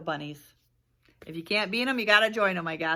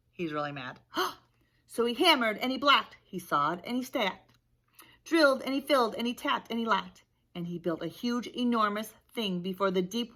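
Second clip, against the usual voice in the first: ordinary delivery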